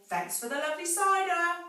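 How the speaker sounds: high, loud mocking thanks; cheeky call. A narrator's reading. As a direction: tease Rat as they run off. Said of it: "they called out".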